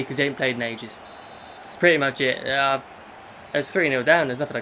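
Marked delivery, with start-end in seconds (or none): none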